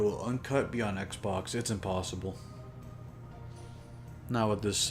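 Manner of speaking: usual speaking voice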